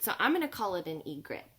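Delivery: ordinary delivery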